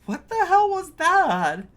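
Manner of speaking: high, loud disbelieving outburst; can't believe what you just saw; real shocked exclamation; stunned disbelief. Real, not acted. Reacting to bizarre transport.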